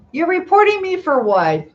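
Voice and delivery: high, loud "for what?"; indignant disbelief. Real, not acted. Streamer reacts to a viewer reporting her, indignant and loud. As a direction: call out the viewer's threat in disbelief.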